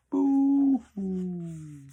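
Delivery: loud, high mock wail; sneering, theatrical boo-hoo, high and loud. A narrator's reading. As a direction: ham up a fake crying wail. Grinch mocks the Whos crying.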